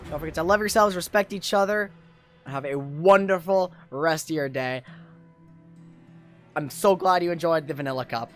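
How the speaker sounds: usual speaking voice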